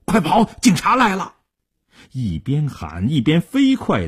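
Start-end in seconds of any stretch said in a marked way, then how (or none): 0.0-1.4 high, urgent warning cry; raised, urgent lookout's shout; sudden shout. A narrator's reading. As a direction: yell a warning to your accomplices to flee. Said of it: "突然大声地喊".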